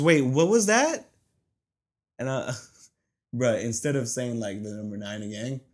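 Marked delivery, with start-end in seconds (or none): 0.0-1.1 much higher, louder, slow puzzled question; drive-thru worker doubting the order, loud and high; confused worker. Retold or imitated. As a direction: ask it like she can't believe it. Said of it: "And she goes".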